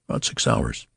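breathy, hushed admission; embarrassed near-whisper. Acted. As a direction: whisper it, ashamed. Embarrassed father's low answer.

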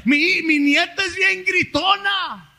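chuckling through the words; amused grandfather laughing; laughing aside. Real, not acted. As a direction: laugh as you admit it.

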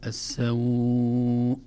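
السوء with a very long held aw; longest madd. Shown as a demonstration. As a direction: chant السوء, drawing out aw to the full. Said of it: "هكذا يسمى مد اللين".

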